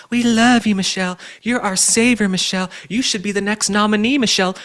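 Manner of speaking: high, gushing mock adoration; mock fawning media. Mimicking the media praising Michelle Obama, mock adoring.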